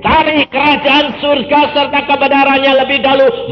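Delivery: loud, rising pulpit proclamation; preacher proclaiming God's faithfulness; full-force preaching. Acted. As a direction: drive the promise home to the congregation.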